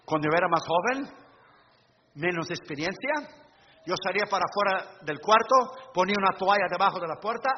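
normal talking voice

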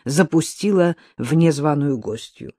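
ordinary delivery, nothing marked

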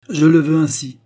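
high, loud agitated command; heated royal outburst. A narrator's reading. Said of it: "fit le roi fort agité".

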